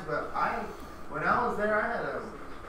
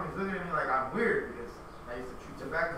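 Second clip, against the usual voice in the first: ordinary delivery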